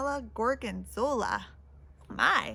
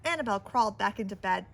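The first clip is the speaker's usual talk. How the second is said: ordinary delivery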